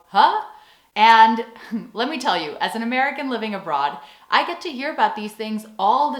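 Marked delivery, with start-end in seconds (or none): 0.1-0.4 loud, high puzzled "huh?"; baffled huh. Acted. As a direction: react as the bewildered rest of the world. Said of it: "rest of the world go".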